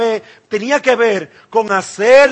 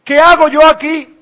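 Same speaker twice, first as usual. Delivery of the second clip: higher and louder, panicked; panicked cry; 7 semitones higher, 8 dB louder than usual. Acted. Isaiah before God's holiness, crying out in panic. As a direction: repeat it as full alarm breaks through. Isaiah's terrified question repeated.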